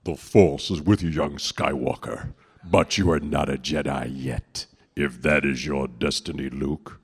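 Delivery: low, commanding space-villain voice; Darth Vader. Acted. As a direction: pronounce it low and weighty like a masked dark lord. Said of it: "Should I do Darth Vader?".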